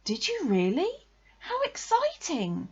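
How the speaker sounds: put-on high-pitched thrilled child's response; excited. A narrator's reading. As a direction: gush with wide-eyed, high-voiced interest. Christopher Robin reacts to the news.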